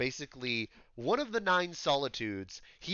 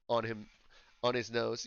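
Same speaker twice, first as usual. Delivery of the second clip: ordinary talk, nothing marked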